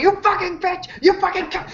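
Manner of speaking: high, strained mock scream of curses; retold furious screaming. Player retells his furious character cursing the fleeing car, high mock scream. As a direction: hurl the curses like a half-acted tantrum. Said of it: "screaming obscenities as I'm going".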